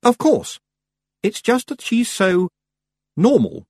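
high and insistent, groping for the word; high-pitched, puzzled explaining; Miss Tick aloud. Acted. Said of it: "Miss Tick said aloud".

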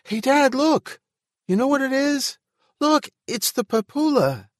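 put-on very high, loud child voice; excited small boy. A narrator's reading. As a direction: call out to Dad in a high, thrilled little-boy voice. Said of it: "gesturing excitedly".

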